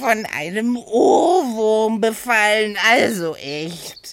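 hohe, neugierige Figurenstimme; Oda, verspielt. Acted. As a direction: quirlig und naschhaft nachfragen. Oda voice continues. (hohe Stimme, schwärmerisch gedehnt)

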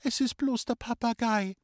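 put-on high, reassuring girl's call with falling end. A narrator's reading. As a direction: call it out as harmless news. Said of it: "hat gerufen". (hoch und beruhigend gerufen)

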